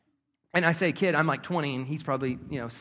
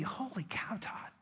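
awed whisper; 15 dB quieter than usual. Retold or imitated. Realizing a lesson, to himself.

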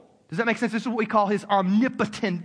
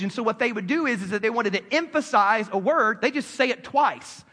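ordinary talk

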